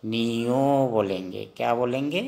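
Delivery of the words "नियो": "नियो" drawn out slowly as "ni-yo"; Assamese letter name. Shown as a demonstration. Teacher showing students the Assamese letter, slow "ni-yo" pronunciation. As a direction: stretch the final o of "नियो". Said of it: "य को हम असमिया में".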